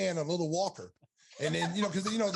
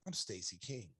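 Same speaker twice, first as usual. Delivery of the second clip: low and much softer; quiet, lower self-reassurance; self pep talk; 10 semitones lower, 12 dB quieter than usual. Retold or imitated. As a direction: tell yourself quietly who you are. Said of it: "I said".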